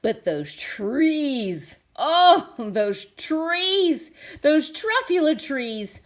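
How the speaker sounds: slow start building to a high, loud gasp of wonder; awed delight. Acted. Once-ler sees the truffula trees, swooning wonder.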